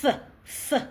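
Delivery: "Th" said as a clean, drawn-out voiceless th; tongue between teeth, "th" held alone; th sound demo. Shown as a demonstration. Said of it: "when they make a hard sound".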